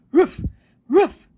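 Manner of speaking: loud voiced woof woof; dog answering the boy; dog barks. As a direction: bark like a happy dog. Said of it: "replied Scamp".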